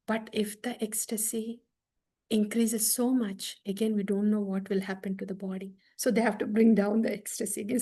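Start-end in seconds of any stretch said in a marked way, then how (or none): none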